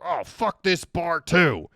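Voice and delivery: loud, high, drawn-out oafish complaint; hammed-up disgruntled American drawl; mock angry outburst. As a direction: holler it like a huffy tourist storming out. Retold tourist storms out of bar.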